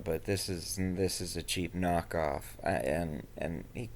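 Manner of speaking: low, soft, unimpressed brush-off; dismissive. Real, not acted. User telling the Doctor why Ram Dass disappoints, dismissive. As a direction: wave it away under your breath. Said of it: "amused at how dismissive you sound".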